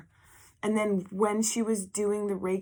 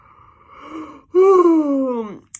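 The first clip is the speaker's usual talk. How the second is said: loud gasp; 9 semitones higher, 14 dB louder than usual. Real, not acted. Second big gasp.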